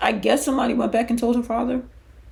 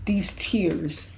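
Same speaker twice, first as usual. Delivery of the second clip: usual speaking voice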